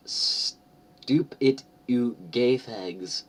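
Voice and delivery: high, slow whiny rant; sneering parody of a teen author telling readers off; mock angry fangirl. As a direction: ham up the writer's furious note. Reading the author's note in a put-on voice.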